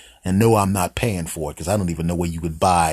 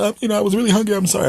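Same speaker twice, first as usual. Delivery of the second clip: high-pitched, louder squirrel voice; apologetic squirrel; 4 dB louder, much faster than usual. Acted. Caught squirrel apologising to the trash can's owner, squeaky and sorry. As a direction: plead sheepishly in a squeaky cartoon voice.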